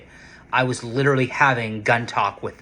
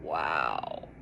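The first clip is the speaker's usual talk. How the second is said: hushed awe; 9 semitones lower, 10 dB quieter than usual. Retold or imitated. Awed at the rare pistol.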